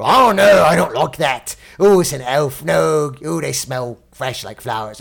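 imitating a player stuck in his dwarf character; grumpy dwarf voice. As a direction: ham up a fussy dwarf who hates elves. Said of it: "He was like a dwarf".

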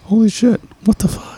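high, amazed gasp of disbelief; stunned. Retold or imitated. Telling a friend his stunned reaction, high and amazed. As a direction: squeak it out in shock. Retelling own shock mid-act.

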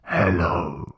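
low zombie groan greeting; lightly voiced zombie hello. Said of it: "Zombie sound".

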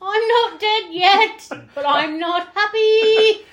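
high, loud, drawn-out joke voice; slow hammed-up wail, way up in pitch; mock dying victim. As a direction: play a not-quite-dead victim.

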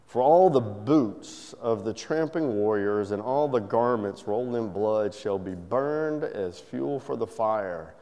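low, soft, reverent reading; scripture reading. Acted. Preacher reading Isaiah to the congregation, soft and reverent. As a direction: read the prophecy gently and low.

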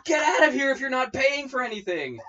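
high, loud shrieking shopkeeper; furious vendor yelling at a customer; angry shout. Retold or imitated. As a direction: scream her off your stall. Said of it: "And the lady's like".

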